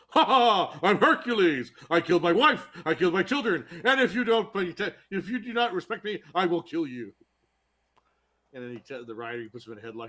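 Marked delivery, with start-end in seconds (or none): 0.1-7.1 booming, laughing braggart, high and loud; hammy Hercules boast. Retold or imitated. Actor playing Hercules boasting to rider, laughing loud and high. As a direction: roar it like a deranged TV hero laughing at his own crimes. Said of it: "He goes".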